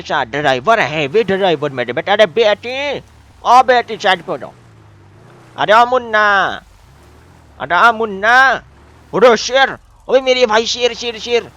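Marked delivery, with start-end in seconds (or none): 2.1-4.5 calling out. Real, not acted. Calling out to girl on road. 5.6-8.5 slow, high, loud drawn-out call. Real, not acted. Calling out to animal. 9.1-11.5 calling out. Real, not acted. Calling to the tiger.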